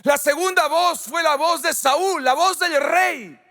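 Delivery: high, forceful pulpit delivery; impassioned preaching. Real, not acted. (predicación apasionada)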